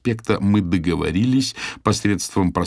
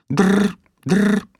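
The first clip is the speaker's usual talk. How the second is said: phone ring imitation; 13 semitones higher, 5 dB louder than usual.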